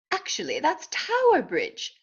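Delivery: high British know-it-all duck; British accent. A narrator's reading. Duck corrects Dodsworth on the bridge, British accent. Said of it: "He spoke with a British accent".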